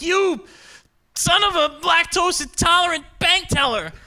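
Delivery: high, exasperated mock cursing; pissed-off outburst. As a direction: spit out a kid-safe insult as if swearing. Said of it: "And I'm getting pissed".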